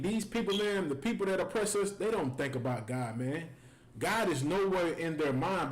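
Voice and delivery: usual speaking voice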